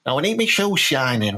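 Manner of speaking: ordinary talk, nothing marked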